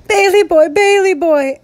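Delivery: high, loud heartbroken call. A narrator's reading. Grandmother calling to her son after the shots, heartbroken.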